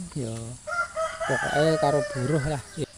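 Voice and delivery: usual speaking voice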